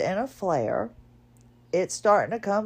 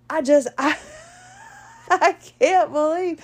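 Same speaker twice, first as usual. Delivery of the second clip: laughing-crying; 8 semitones higher, 4 dB louder than usual. Real, not acted. Laughing at own embarrassment.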